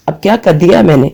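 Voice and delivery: usual speaking voice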